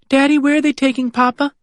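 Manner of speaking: put-on high, worried child voice; a small girl asking her father for reassurance; anxious little girl. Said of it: "Annie tugs anxiously".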